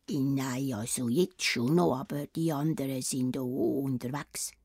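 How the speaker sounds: low, whispered nervous wolf voice; timid wolf. Acted. The wolf admitting to Rotkäppli that he's alone. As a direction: mumble it low like a scared wolf.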